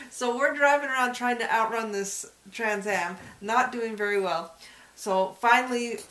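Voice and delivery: ordinary talk, nothing marked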